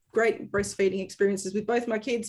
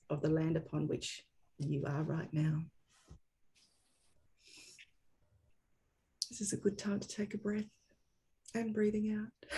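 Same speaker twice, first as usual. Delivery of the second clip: softer, hushed guiding voice; calm guided pause; 4 semitones lower, 8 dB quieter than usual. Real, not acted. Host leading attendees in a quiet breathing moment, calm and soft. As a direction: ease listeners gently into a breath.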